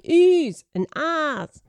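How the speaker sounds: high, swooping little worm exclamations; tiny oohs and ahs. Acted. Chorus of biscuit worms admiring, tiny high oohs and ahs. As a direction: swoop the words up like tiny creatures. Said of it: "There was a chorus of tiny".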